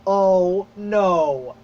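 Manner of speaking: loud, dismayed oh no; the sinking dread of watching it happen. Retold or imitated. A fan retelling the movie ending, loud dismay. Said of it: "I see the movie, I'm like".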